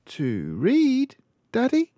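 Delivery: put-on high, sweet little girl pleading. A narrator's reading. Small daughter asking her father for a book, high and sweet. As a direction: ask like a hopeful small daughter.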